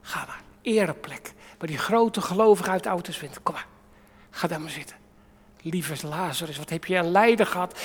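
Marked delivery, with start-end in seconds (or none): none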